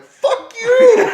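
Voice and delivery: high, loud, laughing yell; loud mock yell through laughter; yelled retort. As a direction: blast it back at him. Retold snapping at the guy.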